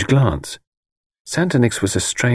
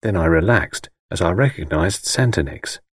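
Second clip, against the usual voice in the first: usual speaking voice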